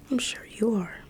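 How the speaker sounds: ordinary talk, nothing marked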